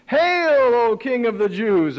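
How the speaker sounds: high, loud sneering salute; mocking hail. Soldiers mocking Jesus in a robe, sneering and loud.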